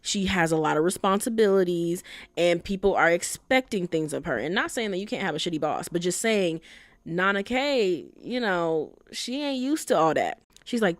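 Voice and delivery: usual speaking voice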